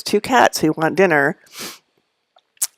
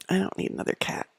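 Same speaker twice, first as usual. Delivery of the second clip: soft unvoiced aside to yourself; a whispered reminder to herself; 2 semitones higher, 13 dB quieter, faster than usual. Real, not acted. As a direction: whisper it like a confession. Aside about adopting Tilly.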